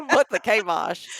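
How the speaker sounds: "kabosh" as KAY-bosh, laughing; loud, amused KAY in "kabosh"; long A at the start of "kabosh"; playful mispronunciation. Shown as a demonstration. Laughingly repeats the wrong pronunciation.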